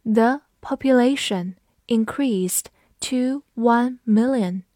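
The population increased to one million, slow, word by word; teacher's slow model reading, pausing between chunks; slow read-along. Shown as a demonstration. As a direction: read it out slowly for learners to follow. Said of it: "我们慢读一遍".

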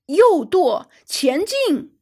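loud, high-pitched shouted order; captain's command. A narrator's reading. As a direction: call out helm orders forcefully. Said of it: "法拉格特舰长命令道". (高声下达命令)

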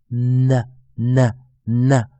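"N" as a held "nnn-uh", not the letter name; N sound. Shown as a demonstration. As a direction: model "N" as three slow, drawn-out sounds. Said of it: "K不发音".

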